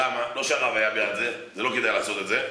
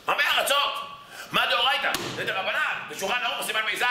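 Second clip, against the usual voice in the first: much higher, jolted awake blurting the ruling; startled outburst; 10 semitones higher than usual. Retold or imitated. Dozing rabbi jolted awake, blurting the ruling high. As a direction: snap awake and fire off the answer.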